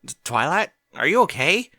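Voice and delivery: startled question. Acted. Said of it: "Applejack asked with a start".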